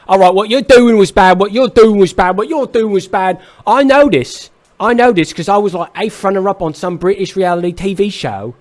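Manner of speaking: loud, high mock British accent; shouted impression of a British scout. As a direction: bark it out like an angry English music boss. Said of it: "That was a really good accent".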